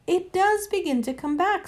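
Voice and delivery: high-pitched, musing horse; mildly pensive character voice; thoughtful. A narrator's reading. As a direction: think aloud as the memory returns. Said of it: "said the horse thoughtfully".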